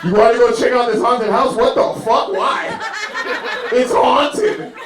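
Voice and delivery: high-pitched, loud incredulous protest; mock outrage. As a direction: protest in comic disbelief, voice shooting up. Mock outrage at haunted house.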